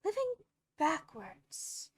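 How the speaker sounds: high, loud first word, then a drop; great astonishment. A narrator's reading. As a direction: repeat it in utter disbelief. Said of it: "Alice replied in great astonishment".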